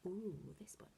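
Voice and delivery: soft secretive whisper. Shown as a demonstration. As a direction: hush it, like a secret. Whispered aside in flashcard drill.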